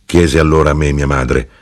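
plain reading voice

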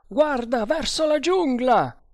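high, urgent cry of warning; shouted alarm. A narrator's reading. A voice in the bungalow warns the others, shouting. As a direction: shout a warning toward the jungle. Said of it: "gridava una voce".

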